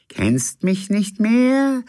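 a second spectre, pitched high; another ghost. Acted. Second ghost confronts the captain, high and slow. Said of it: "sagte eine andere Stimme".

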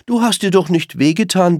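höher und lauter, munter aufmunternd; fröhlich gerufen. Acted. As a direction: Sorgen mit Fröhlichkeit überspielen. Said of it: "ruft so fröhlich". (Mama Reh ruft dem gestürzten Kind fröhlich zu)

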